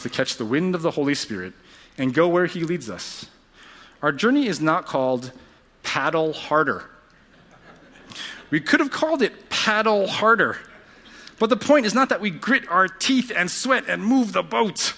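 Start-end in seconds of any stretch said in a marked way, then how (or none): 4.0-14.9 high, straining exhortation; emphatic preaching. Real, not acted. Preacher tells congregation not to just paddle harder, emphatic. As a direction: drive the point home, pitched high. Preacher stresses what journey isn't.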